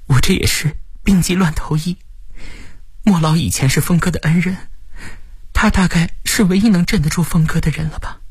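high-pitched character voice, explaining; relieved rescuer character. Acted. Voices Lu Suxin replying.

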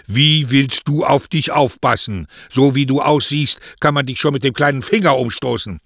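high, loud, scolding maternal voice; scoffing mother, exasperated. Acted. Mother scoffs at daughter's claim to self-reliance, exasperated.

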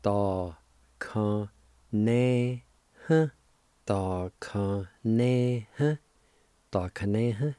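Da, ka, ne, huh said apart; syllables of dakaneha. Shown as a demonstration. Teacher sounding out Da, ka, ne, huh slowly. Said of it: "it's pronounced like this".

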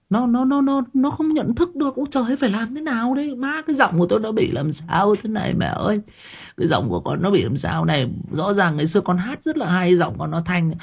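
put-on a boy horrified by his breaking voice; panicked teen boy. (cậu bé tuổi dậy thì hoảng hốt, giọng cao vống)

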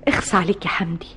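shocked, breathless whisper of reproach; appalled whisper. Acted. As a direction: whisper it, horrified at him. Wife appalled by husband's words.

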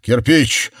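urgent excited call. A narrator's reading. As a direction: shout the news across the house. Calling the news across the house.

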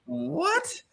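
incredulous outburst. Retold or imitated. Boy to teacher, shocked and protesting innocence. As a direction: squeak out the disbelief, then plead innocence. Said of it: "And I'm like".

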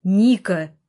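loud, short shout of a name; barked call. A narrator's reading. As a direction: bark it after your sister. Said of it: "гаркнул я". (громкий резкий оклик)

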